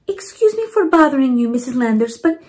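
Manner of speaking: Mama Provi politely asking the neighbour; grandmother's voice. Acted. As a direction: ask sweetly like a kind grandma. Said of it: "Mama Provi asked".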